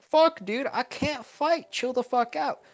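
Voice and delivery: high, loud panic, very slow on I can't fight; panicked Naruto. Acted. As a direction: shriek in comic terror. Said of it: "He's like".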